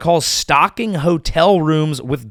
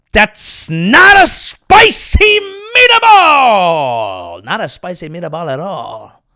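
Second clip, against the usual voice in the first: hammed-up Italian accent; 6 semitones higher, 10 dB louder, slower than usual. Comic Italian customer wailing about the meatball, hammed-up. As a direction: belt it like a cartoon Italian chef.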